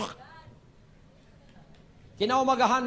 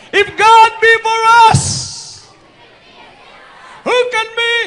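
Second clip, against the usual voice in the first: shouted scripture; 11 semitones higher, 14 dB louder than usual. Real, not acted. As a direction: hurl the verse at the hall. (malakas at mataas na pagpapahayag ng kasulatan)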